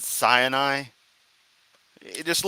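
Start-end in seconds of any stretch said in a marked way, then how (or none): 0.0-0.8 "Sinai" in three syllables, SIGH-uh-nye; mimicked mispronunciation. Commentator mocking the preacher's pronunciation, "Sinai" as SIGH-uh-nye. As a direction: stretch "Sinai" with an extra uh in the middle. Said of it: "Notice he said Sinai".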